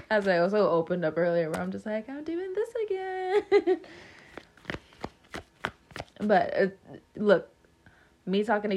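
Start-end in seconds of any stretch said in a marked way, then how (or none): 2.1-3.8 high voice dissolving into laughter; laughing mock complaint. Quoting own earlier self to viewers, high mock complaint. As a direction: squeal it through a laugh.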